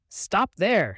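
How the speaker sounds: loud command; shout. A narrator's reading. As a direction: call out to halt him. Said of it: "she shouted".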